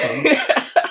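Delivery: squealed, drawn-out retold greeting; exaggerated high-pitched hello. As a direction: call it out high and long, mock-friendly. Retells her over-friendly greeting.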